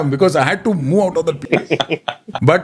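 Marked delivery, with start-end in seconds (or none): none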